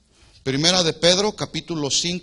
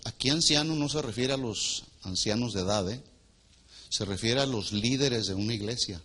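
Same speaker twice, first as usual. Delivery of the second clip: normal talking voice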